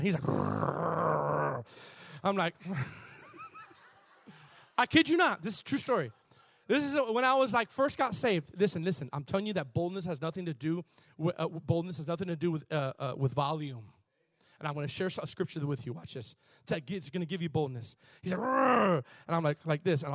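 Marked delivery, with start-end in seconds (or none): 0.2-1.6 long imitated demon growl. Preacher imitating the demon beside him, long growl. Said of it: "the demon was just kinda". 18.4-19.0 loud, high mock growl; demon growl. Preacher imitating the manifesting man, loud growl. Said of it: "the demon was just kinda".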